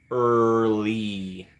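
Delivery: EARLY stretched very long on a level tone; drawn-out word. Real, not acted. Streamer remarks on a viewer's arrival, EARLY slowly drawn out.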